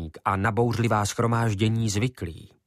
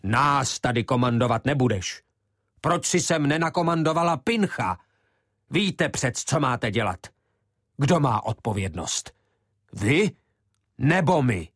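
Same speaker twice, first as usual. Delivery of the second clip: passionate retort; 4 semitones higher than usual. Acted. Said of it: "Podle vášnivosti, s jakou vrhal".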